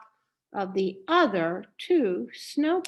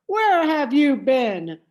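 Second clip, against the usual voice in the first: louder, booming scolding grown-ups; big blustery voices; 4 semitones higher, 7 dB louder than usual. A narrator's reading. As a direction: fill the room like bossy big women. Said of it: "in their big, blustery voices".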